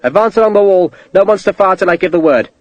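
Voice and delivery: loud, firm orders; officer ordering the advance; commanding. A narrator's reading. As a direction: give the order over the noise.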